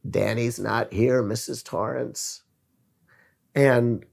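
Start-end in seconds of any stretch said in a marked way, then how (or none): 0.1-2.4 slow, hollow, softly voiced little boy, fading out; a hint of a possessed child speaking slowly, dropping away; Tony voice. Retelling The Shining, a light imitation of Tony's slow, fading voice. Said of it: "in his tony voice".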